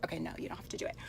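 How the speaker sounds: breathy whispered aside; hushed afterthought. Real, not acted. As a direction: drop to a whisper to take it back. Playful aside after instruction.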